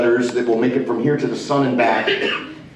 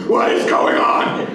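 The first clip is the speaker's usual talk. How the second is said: freaking out; 7 semitones higher than usual. Retold or imitated. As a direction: exclaim it in exaggerated overwhelmed disbelief. Retells freaking out back in his room.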